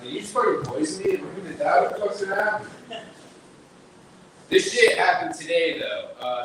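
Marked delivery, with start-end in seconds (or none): 0.0-2.7 panicked customer. Retold or imitated. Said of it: "He's like".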